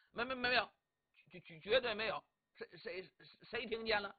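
flustered, tripping over the denial; nervous stammered denial. Acted. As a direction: stutter on the first word of each phrase. Nervously denies having grumbled.

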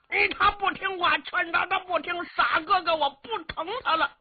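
put-on high, fast, childlike voice of a dim giant; simple-minded young giant. Simple young hero to the squire, high childish blurting. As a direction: blurt it out like a big naive boy.

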